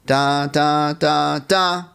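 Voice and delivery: each da punched a little harder; accented syllables. Shown as a demonstration. Teacher demonstrating accents to the students, each da punched. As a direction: hit every da like an accent. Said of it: "Like an accent is louder".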